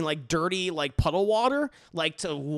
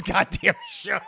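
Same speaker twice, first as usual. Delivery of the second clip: usual speaking voice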